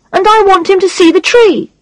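put-on high, insistent young boy; little boy voice. A narrator's reading. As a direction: plead like a small child. Child character voice.

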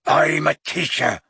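mildly roared indignation; roaring. A narrator's reading. Hagrid rebukes Harry at the door, roaring. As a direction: thunder it in character, not full volume. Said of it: "he roared at Harry".

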